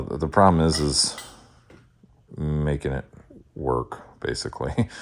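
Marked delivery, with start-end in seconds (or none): 2.4-4.9 holding back hiccups; words caught between hiccups; suppressing hiccups. Real, not acted. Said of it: "excuse me, hiccups".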